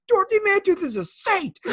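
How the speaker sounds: very high-pitched hollered quote; playful high holler; mock yell out the window. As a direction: yell it like a drive-by movie quote. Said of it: "I'll just yell out the window".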